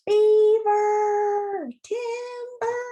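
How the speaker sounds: high, long drawn-out holler; loud sustained high cry, easing off; timber call. A narrator's reading. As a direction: holler it out as the tree falls. Beaver calls as tree falls.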